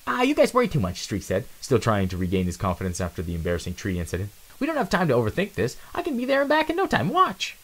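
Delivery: high, quick and cocky; a restless kid who can't wait; high, impatient youngster; eager young mouse. Acted.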